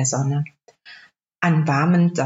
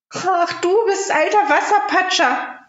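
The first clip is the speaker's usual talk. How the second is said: put-on much higher, girlish princess voice; a princess scolding the frog; young princess; 12 semitones higher than usual. (hohe Prinzessinnenstimme)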